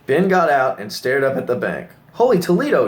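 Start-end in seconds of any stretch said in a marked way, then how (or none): 2.1-2.9 high, loud astonished cry; shocked exclamation. Acted. Boss stares up at the bank, astonished. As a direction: gasp it out in disbelief.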